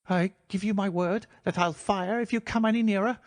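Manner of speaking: a scared man bluffing with a threat; reedy quivering voice. A narrator's reading. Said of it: "a reedy, quivering voice".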